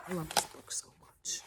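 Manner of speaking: breathy whispered aside to the camera; whispered confession of love for the book. Real, not acted. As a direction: lean in and whisper it like a secret. Teacher gushing over favourite book.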